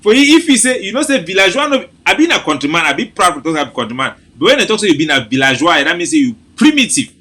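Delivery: high, giggly, laughing through words; can't stop laughing as you speak; laughing while talking. Real, not acted. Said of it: "my belly dey laugh the joke and kill me".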